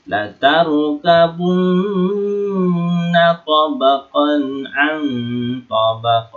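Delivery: slow recitation, droning long on the n of "tarkabunna" and "an"; gunnah. Shown as a demonstration. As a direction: hold the nn of "tarkabunna" in a long hum. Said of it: "maka dibaca apa? Gunnah".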